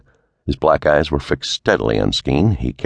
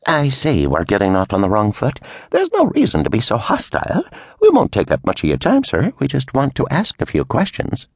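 higher, pushy official; Cleary's voice; 8 semitones higher than usual. A narrator's reading. Pushy official smoothing things over, higher voice. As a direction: press him with smooth politeness.

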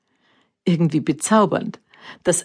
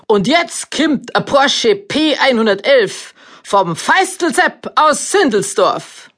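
frenetic announcer; 7 semitones higher, slower than usual. A narrator's reading. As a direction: bellow it like a frenzied announcer. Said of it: "so frenetisch, wie er bei jedem Teilnehmer brüllte".

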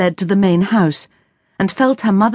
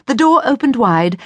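ordinary delivery, nothing marked

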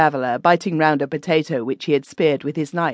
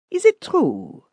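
high, prying German widow; pry into it in a high, nosy voice; 12 semitones higher than usual. Acted. The widow quizzes the narrator at dinner, high and nosy. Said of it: "asked the widow".

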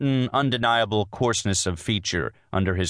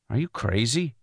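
higher-pitched, mild challenge; demanding; 5 semitones higher than usual. A narrator's reading. Husband stares at wife in disbelief, demanding. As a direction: challenge her, without raising volume. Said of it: "he demanded".

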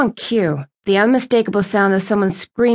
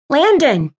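exasperated scolding; 6 semitones higher than usual. A narrator's reading. Scolds him, smacks his arm.

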